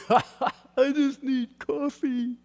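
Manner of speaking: high, very slow, laughing through the words; child's line through laughter. Retold or imitated. Preacher laughingly repeats granddaughter's coffee line. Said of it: "child quoted again, laughing".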